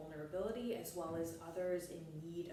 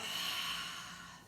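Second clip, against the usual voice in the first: long, voiceless sigh out; demonstrated sigh. Shown as a demonstration. Teacher showing the group a letting-go breath, long sighed exhale. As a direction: let the breath go in an audible sigh. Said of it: "sigh it out".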